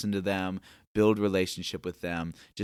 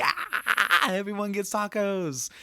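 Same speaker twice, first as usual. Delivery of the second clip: much higher, squealing hype voice; hammed-up giddy cheer; mock over-the-top excitement; going bonkers; 11 semitones higher, slower than usual. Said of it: "going bonkers".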